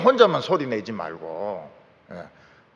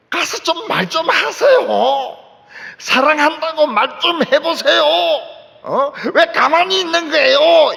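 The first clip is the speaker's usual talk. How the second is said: frantic caregiver shouting at a silent husband; caregiver yelling at husband; 10 semitones higher, 7 dB louder than usual. Retold or imitated. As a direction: shout at him to go say it. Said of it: "그 남편 보고 이야기를 한 겁니다".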